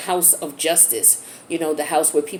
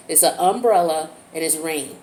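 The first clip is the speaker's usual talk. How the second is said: ordinary talk, nothing marked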